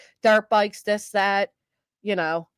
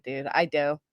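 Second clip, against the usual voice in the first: ordinary delivery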